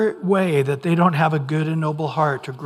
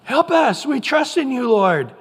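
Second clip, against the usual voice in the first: much higher, pleading to God; 7 semitones higher, 4 dB louder than usual. Retold or imitated. Israelites crying out to God, high and pleading. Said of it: "Towards God".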